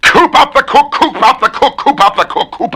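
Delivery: a twister piped in falsetto; very high squeak. Shown as a demonstration. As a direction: squeak it out like a little bird. Repeated very high.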